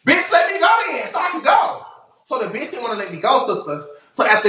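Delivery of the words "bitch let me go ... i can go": high shouted demand to be let go; high yell. Retold or imitated. Retelling yelling at the woman holding her, high shout.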